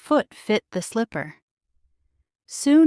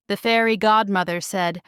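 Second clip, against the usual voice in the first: ordinary delivery, nothing marked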